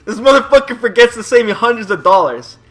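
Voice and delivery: loud disbelief at the clerk; outraged. Real, not acted.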